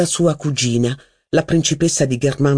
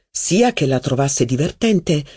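ordinary delivery

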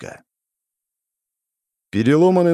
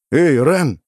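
louder, higher call; 5 semitones higher, 5 dB louder than usual. A narrator's reading. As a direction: call out across the field. Calling out to Randel. (громкий оклик)

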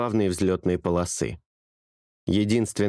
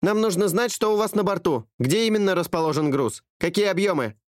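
much higher, crisp radio dispatcher; female dispatcher voice; 10 semitones higher than usual. A narrator's reading. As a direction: answer like an air traffic controller. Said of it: "ответила немедленно". (высокий голос диспетчера по рации)

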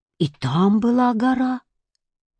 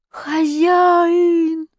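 cat calling; 9 semitones higher than usual. A narrator's reading. Cat calls from afar.

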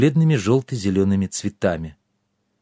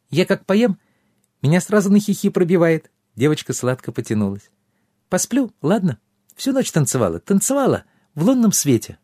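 pixie; 7 semitones higher than usual. A narrator's reading. Giggly pixie.

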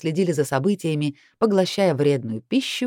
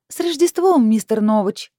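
very high, loud, sweet greeting; sugary singsong; 5 semitones higher, 5 dB louder than usual. A narrator's reading. Heroine buttering up her boss by phone, sickly sweet. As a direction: sing it out sweetly, pitched way up. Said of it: "сладко пропела я в трубку".

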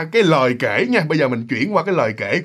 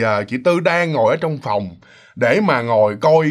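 ordinary delivery

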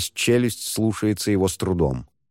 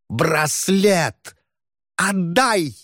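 high wailing ghostly demand; shriek-wail like an angry ghost; sobbing ghost voice; 9 semitones higher, much slower than usual. Acted. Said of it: "С каждым стоном призрак".

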